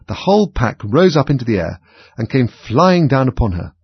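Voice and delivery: ordinary delivery, nothing marked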